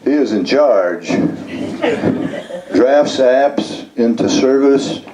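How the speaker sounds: measured poem recital with lifted line endings; performed poem, each item in the list set apart; poetry reading. Acted. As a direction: read it as verse, pausing between phrases and letting list items rise. Reads own poem aloud.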